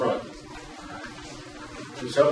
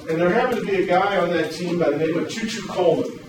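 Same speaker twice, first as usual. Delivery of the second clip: ordinary talk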